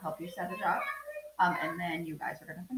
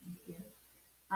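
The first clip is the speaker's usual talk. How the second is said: normal talking voice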